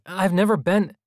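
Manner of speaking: high loud denial, cut off mid-sentence; protesting aloud. A narrator's reading. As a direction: push back hard out loud. Omri protests aloud to parents.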